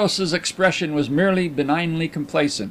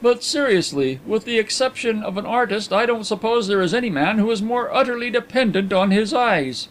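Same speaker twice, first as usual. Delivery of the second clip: high-pitched, earnest gentleman; Carlyle; 8 semitones higher than usual. A narrator's reading. Carlyle doubting a blind detective, high and earnest. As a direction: reason it out in a raised voice. Voices Carlyle reasoning with Carrados.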